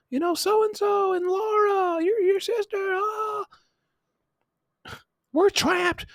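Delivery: very high, loud, squeaky cry for help; high mock panic voice. Pranksters on the machine begging Paul for help in squeaky panic. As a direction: squeal it like trapped kids begging.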